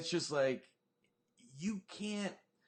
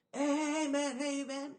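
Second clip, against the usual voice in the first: high, loud hey; a stranger shouting for attention; mock calling out; 12 semitones higher, 6 dB louder, much slower than usual. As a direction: call after someone down the street. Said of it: "dude running up calling".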